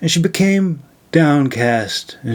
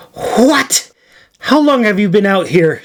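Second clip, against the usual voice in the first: shocked question, pitched higher; startled demand; 7 semitones higher than usual. A narrator's reading. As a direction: blurt it out in alarm. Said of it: "she demanded".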